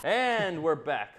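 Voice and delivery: slow, loud show-host return; announcer. Acted. Host kicking off the soapbox segment, slow announcer.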